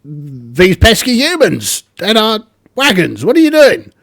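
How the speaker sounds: high, loud squawking goblin, soaring up at the end; indignant goblin. Acted. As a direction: play a shrill goblin caught out by humans. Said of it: "And the goblins go".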